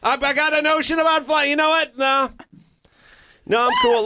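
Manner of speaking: high, loud show-off pelican; hammy bird bragging about flying. Retold or imitated. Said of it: "They do a, like".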